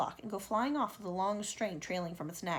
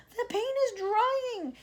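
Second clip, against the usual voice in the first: high, slow moan; centipede moaning; 13 semitones higher, 5 dB louder, much slower than usual. Acted. Centipede moaning to friends that the paint is drying. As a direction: drag it out in misery. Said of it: "he moaned".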